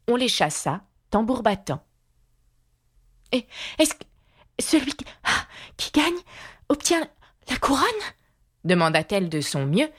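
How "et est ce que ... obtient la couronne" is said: put-on high, halting girl's voice broken by gasps; out of breath. A narrator's reading. Alice questions the king while running, out of breath. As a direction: gasp the question out in short bursts. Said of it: "car elle était hors d'haleine à force de courir".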